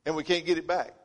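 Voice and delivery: ordinary delivery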